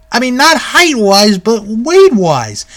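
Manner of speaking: usual speaking voice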